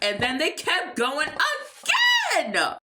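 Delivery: slow, high, drawn-out exclaiming; high-pitched dramatic telling; worked-up narration. A narrator's reading. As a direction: stretch it out, high and exasperated. Narration carried away by the quarrel.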